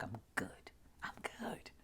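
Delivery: soft whisper, calming herself; whispered reassurance. Retold or imitated. She calms herself after the fear, in a soft whisper. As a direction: breathe it gently to settle your nerves.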